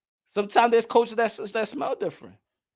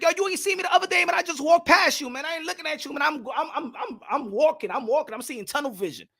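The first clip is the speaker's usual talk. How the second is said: fast and higher, tossed off; rushed New Yorker excuse; 6 semitones higher than usual. Retold or imitated. New Yorker explaining he walked past a friend, fast and high. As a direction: rattle it off high and quick like you're late. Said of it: "sometimes you be like".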